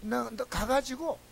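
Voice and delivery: normal talking voice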